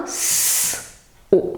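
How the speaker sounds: long S, pause, then O; S and O sounded one by one; sounds repeated separately. Shown as a demonstration. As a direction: stretch the S as if writing it, then O. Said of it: "il l'écrit en répétant".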